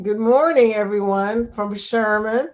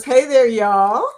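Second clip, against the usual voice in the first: slow, louder Texas drawl, imitating a viewer; 6 dB louder, slower than usual. Host reading a viewer's greeting aloud in a mock Texas drawl. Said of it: "in her Texas drawl".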